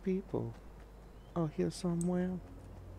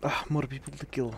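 ordinary delivery

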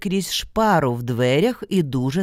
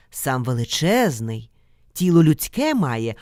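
ordinary delivery, nothing marked

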